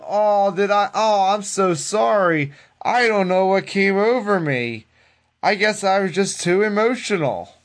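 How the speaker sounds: high, mock-apologetic sarcasm; sarcastic. Wrestler answering an interviewer about ruining the match, super sarcastic imitation. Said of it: "very sarcastically going".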